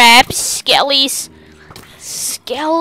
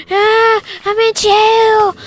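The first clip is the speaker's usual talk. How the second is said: squeaky voice; 9 semitones higher, 3 dB louder than usual. Pretend. Squeaky jailed character voice.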